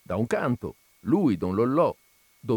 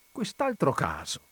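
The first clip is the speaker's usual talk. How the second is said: normal talking voice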